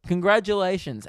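high, slow mock congratulations; sarcastic. Mocking TikTok users, slow sarcastic praise. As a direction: drip sarcasm on each word. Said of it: "I'm like".

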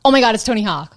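mock American excitement, oh my God; a light put-on American gush over Tony Hawk; quick American-accented fanboy line. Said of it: "I just wanted to say that in an American accent".

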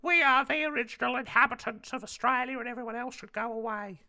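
high, loud, wavering impression; caricatured complaining politician's voice; Pauline Hanson. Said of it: "she'd say something like".

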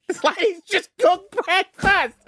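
high, slow disbelief; exasperated. Real, not acted. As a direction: drag out the disbelief. Exasperated ranting.

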